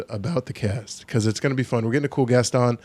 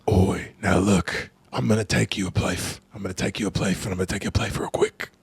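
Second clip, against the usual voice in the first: low, soft, badly faked British tough-guy accent; hammed-up English accent, 'place' ending in F; Statham; 4 semitones lower, 6 dB quieter than usual. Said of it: "the accent that you could have brought to that role".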